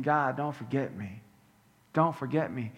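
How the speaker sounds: low, soft, desperate plea; hushed prayer for mercy; quiet pleading. Acted. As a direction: beg softly not to be forgotten. Criminal pleading to God.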